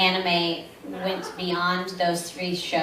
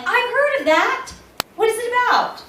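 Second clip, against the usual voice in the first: high eager question; 5 dB louder than usual. Retold or imitated. Seeing shows she half recognizes.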